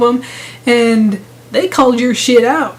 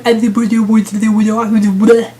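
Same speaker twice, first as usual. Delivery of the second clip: mocking stammer; 2 semitones lower than usual. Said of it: "a kid going".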